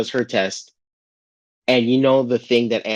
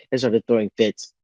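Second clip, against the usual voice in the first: ordinary talk, nothing marked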